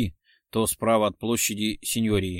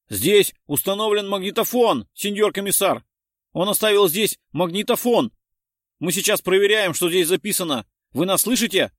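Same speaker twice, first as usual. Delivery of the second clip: high, raised, urgent report; shouting over radio; 8 semitones higher, 5 dB louder than usual. A narrator's reading. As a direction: call out the find to the commissioner. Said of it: "раздался крик Дино". (взволнованный доклад криком по рации)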